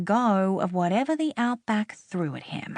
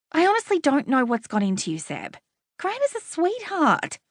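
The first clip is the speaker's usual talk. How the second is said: Lucy, exasperated cousin; 7 semitones higher than usual. Acted. Lucy's voice in narration.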